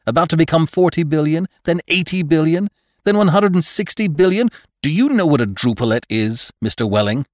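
loud, high, mounting harangue; severe doctor. A narrator's reading. Doctor harangues a father about population control, severely. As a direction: lecture him hard, climbing through the figures.

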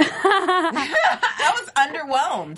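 very slow, laughing disappointment; drawn out underwhelmed. Real, not acted. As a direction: stretch it out, deflated and amused. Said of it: "One sentence from everybody".

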